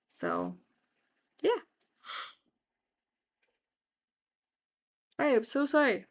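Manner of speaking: through a yawn; stifling a big yawn. Real, not acted. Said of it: "I don't know why I'm yawning".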